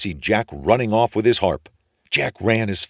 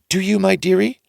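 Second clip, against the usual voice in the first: put-on much higher sweet old wife voice; ogre's wife; 13 semitones higher, 5 dB louder than usual. A narrator's reading. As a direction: coo it like the giant's fussy wife. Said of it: "says the ogre's wife".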